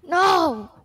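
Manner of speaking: loud sudden no; shout. Real, not acted. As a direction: yell it out in dismay. Yelling at a game death.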